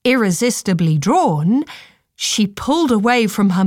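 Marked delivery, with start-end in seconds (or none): none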